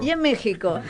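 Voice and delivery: repeating the words through a laugh; laughing as you echo your co-host. Real, not acted. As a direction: say it while cracking up.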